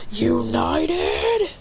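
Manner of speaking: extremely slow, strained move name; drawn-out anime charge-up, climbing high; power-up attack. Acted. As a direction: stretch every word as the power charges. Acted slow power-up attack call.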